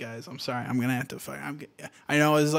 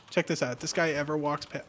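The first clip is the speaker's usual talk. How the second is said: usual speaking voice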